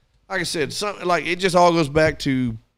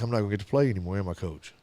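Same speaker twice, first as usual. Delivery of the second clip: put-on sad kid; 6 semitones lower, 8 dB quieter, faster than usual. Crying boy asking his coach if he can play, low and sad. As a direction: lower and much softer, downcast. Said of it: "He was like".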